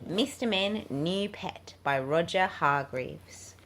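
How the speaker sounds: plain reading voice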